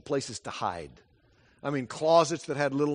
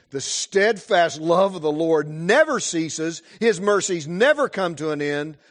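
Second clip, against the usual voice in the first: slow, loud, rising proclamation of the verses; fervent scripture reading; 4 semitones higher, 10 dB louder than usual. Acted. Preacher proclaiming Lamentations to the congregation, loud and fervent. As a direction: preach the promise out with full force.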